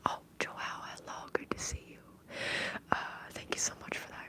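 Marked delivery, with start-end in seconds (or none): none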